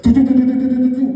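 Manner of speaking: chopping helicopter blades sound, loud; loud propeller noise. Retold or imitated. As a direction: chop out a loud rotor noise.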